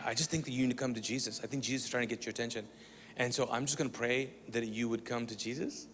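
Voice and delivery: soft prayer. Retold or imitated. Praying over his crying sister, soft and hushed. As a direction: pray quietly as if for her alone.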